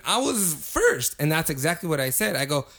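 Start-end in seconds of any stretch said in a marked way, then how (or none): 0.1-1.2 high, drawn-out mock complaint; retold indignant protest. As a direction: protest being skipped, acting it out. Retold quarrel over being served.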